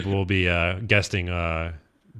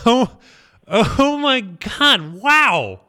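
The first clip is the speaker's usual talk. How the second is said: stunned; 10 semitones higher, 3 dB louder, much slower than usual. Real, not acted. Stunned by commencement invitation.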